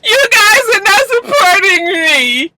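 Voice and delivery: high, slow, whiny sob-talk; imitating a tipsy friend, slurred and weepy; mock drunk crying. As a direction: mimic a fake drunk crying for pity. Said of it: "when you talking like this".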